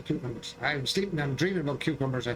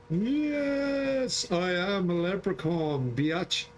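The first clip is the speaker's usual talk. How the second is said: proud leprechaun; 3 semitones higher, much slower than usual. Pretend.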